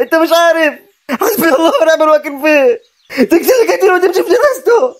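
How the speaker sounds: high, straining angry outburst; worked-up, high-pitched fury; furious accusation. Acted. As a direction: rage at him while being held back. Held back while attacking his cousin.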